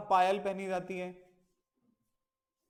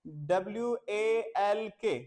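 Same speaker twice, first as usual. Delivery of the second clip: slow letter-by-letter spelling; teacher spelling W-A-L-K slowly; much slower than usual. Shown as a demonstration. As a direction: spell it out for the children. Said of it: "मैंने बताया था".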